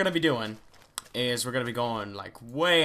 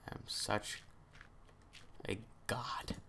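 a smug little whisper; whispered boast; 15 dB quieter, slower than usual. Pretend. Gamer boasting to himself in a whisper. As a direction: breathe the brag under your breath.

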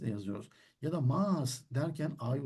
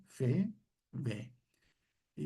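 ordinary talk

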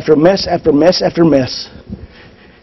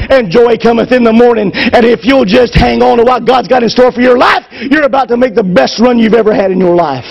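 higher, climbing preacher's call to hold on; rousing preaching; 5 semitones higher than usual. Acted. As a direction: lift the crowd, build it up. Preacher builds to a rousing peak.

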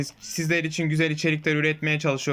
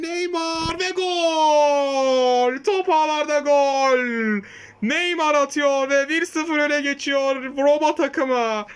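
screaming football commentator; 9 semitones higher, slower than usual. Acted. Goal scored in the game.

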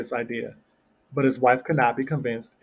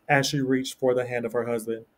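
usual speaking voice

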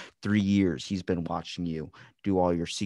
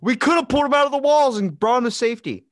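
much higher, loud protesting voice; hammed-up officer complaining; mock police officer; 10 dB louder than usual. Voicing the police in story.

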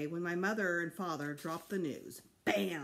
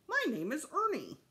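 much higher puppet voice; boy pretending to be Ernie; 9 semitones higher, 3 dB louder than usual. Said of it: "his best Sesame Street voice".

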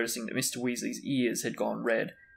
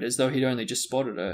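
plain reading voice

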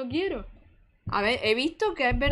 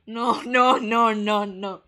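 1 laughing no; 3 semitones lower, 8 dB louder, much slower than usual. Real, not acted. Laughing refusal to open.

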